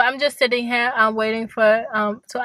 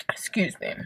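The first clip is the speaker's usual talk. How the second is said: unvoiced little excuse me; whispered excuse me; 8 dB quieter than usual. Real, not acted. While chatting to viewers, whispering an excuse after a burp.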